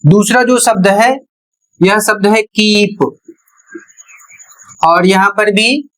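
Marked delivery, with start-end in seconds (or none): 2.4-3.0 "कीप" with a long, stretched ई; long ee pronunciation. Shown as a demonstration. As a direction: draw out the ee in "कीप". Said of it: "उच्चारण लंबा".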